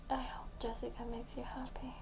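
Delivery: soft, resigned whisper; whispering a sad farewell at the end of a voicemail. Acted. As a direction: say goodbye to the hope on breath only. Ending the heartbroken voicemail.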